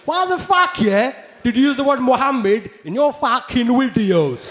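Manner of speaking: high, loud aggressive roadman challenge; angry London roadman. Retold or imitated. Angry fan confronting the comedian, roadman aggression. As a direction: square up like a street lad.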